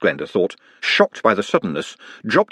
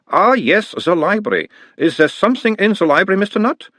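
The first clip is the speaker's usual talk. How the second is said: put-on higher, louder doctor's voice with a light Uberwald accent; mild Uberwald accent; 11 semitones higher, 3 dB louder than usual. A narrator's reading. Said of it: "talking like someone from Uberwald".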